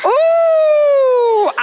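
long high "ooh" held on one note; drawn-out, excited crowd-style ooh; cheering whoop. Real, not acted. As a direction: whoop loudly for the racers. Cheering on the race.

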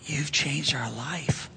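slow, whispered thanks, imitating a moved fan; hushed gratitude. Fan confiding thanks to the pastor, slow hushed whisper. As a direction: whisper it slowly, deeply moved.

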